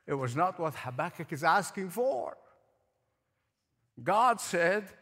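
usual speaking voice

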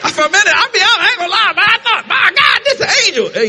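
high loud laughing exclamation; laughing shout. Real, not acted. Preacher telling the angel moment, high laughing shout.

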